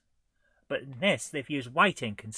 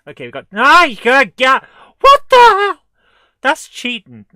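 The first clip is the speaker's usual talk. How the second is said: much higher and louder, yelling; shocked outcry; 11 semitones higher, 19 dB louder than usual. Real, not acted. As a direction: shout in disbelief. Overwhelmed by enemies on screen.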